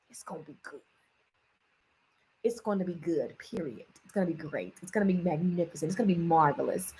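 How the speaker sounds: low, hushed and measured; quiet affirmation. Real, not acted. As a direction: drop it right down, like a quiet promise. Low quiet affirmation after excited declaration.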